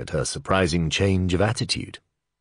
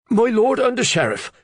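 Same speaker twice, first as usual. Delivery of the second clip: much higher, loud calling cry; cried out; 5 dB louder than usual. Acted. Reeve calling to the under-sheriff from afar, loud cry. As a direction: call out across the yard, excited. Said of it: "Walter cried".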